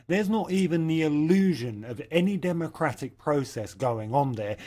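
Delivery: ordinary talk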